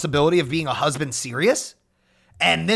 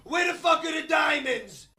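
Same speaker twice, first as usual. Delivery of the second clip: high shouted demand; shouting; 9 semitones higher than usual. Pretend. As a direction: bark it out for the diamonds.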